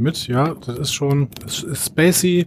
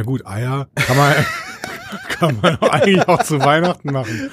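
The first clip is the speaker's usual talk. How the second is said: laughing. Retold or imitated. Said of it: "Die sagen einfach".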